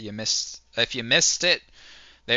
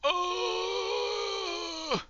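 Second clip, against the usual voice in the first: high excited cry; 5 dB quieter than usual. Real, not acted. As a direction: yelp it out in surprise. Reacting to a high score.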